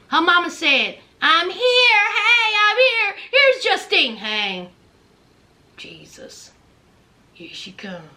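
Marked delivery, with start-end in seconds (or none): 1.2-4.2 higher, sung-out announcement; mama's singsong arrival. Retold or imitated. 4.2-8.1 much softer, lower grumble; muttered mock dread. Justine's dread at mama's arrival.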